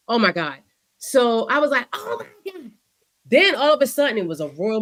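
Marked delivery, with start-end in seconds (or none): 1.9-2.7 high, hushed shocked oh my God; shocked gasp. Retold or imitated. Watching the clip, hushed and stunned. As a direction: breathe it out in disbelief.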